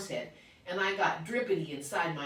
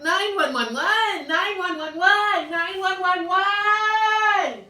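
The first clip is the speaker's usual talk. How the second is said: very high, loud, slow emergency yells; frantic child calling nine one one; hollering; 14 semitones higher, 12 dB louder, much slower than usual. Acted. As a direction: holler for help like a siren. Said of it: "I hollered".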